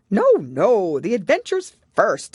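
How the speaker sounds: loud, insistent Gryphon; impatient Gryphon. A narrator's reading. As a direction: cut her off impatiently. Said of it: "in an impatient tone".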